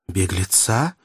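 ordinary delivery

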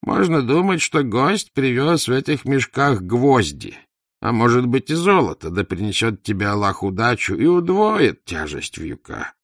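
animated, whining haggler; wheedling idler porter. Acted. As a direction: plead for a bigger tip, stretching the words.

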